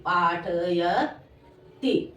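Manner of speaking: पाठयति stretched very slowly and low; syllable by syllable. Shown as a demonstration. Teacher dictating to pupils, पाठयति slowly syllable by syllable. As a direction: sound out पाठयति with long, separated syllables.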